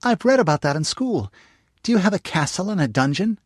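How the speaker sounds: put-on high, eager little girl; young girl voice. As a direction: pitch it up like a curious young girl. Narrator voices young girl Jane.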